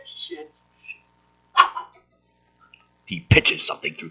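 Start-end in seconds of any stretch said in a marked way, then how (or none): 0.0-1.0 much softer voiceless repeat; whispered echo. Acted. Stoner echoes his insult in a whisper. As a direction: let it fade to a whisper.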